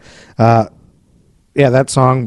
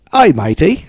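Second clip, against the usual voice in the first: pirate; 5 semitones higher, slower than usual. Pretend. Joking pirate voice meant as an English accent. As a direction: ham it up like a cartoon pirate. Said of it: "You're doing a pirate now?".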